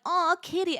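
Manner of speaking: high-pitched mock coo. Bystander spots the loose cat, high cooing.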